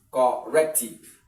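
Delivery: slow, emphatic split of "Corrective"; syllable by syllable. Shown as a demonstration. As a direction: punch out "Corrective" in separate parts. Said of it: "second syllable from here should be".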